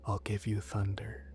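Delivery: soft unvoiced whisper. A narrator's reading. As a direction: whisper the promise. Seductive whispered offer.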